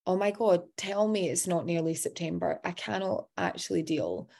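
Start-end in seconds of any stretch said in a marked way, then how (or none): none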